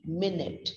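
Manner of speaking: "minute" as MIN-it, stress first; time-unit pronunciation. Shown as a demonstration. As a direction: say "minute" short, like "minnit". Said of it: "It's pronounced as M I N I T".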